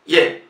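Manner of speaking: Ye said short and loud; the Russian E as Ye; Ye, union of I and E; ye sound. Shown as a demonstration. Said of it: "se pronuncia como ye".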